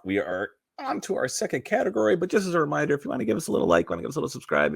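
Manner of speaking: normal talking voice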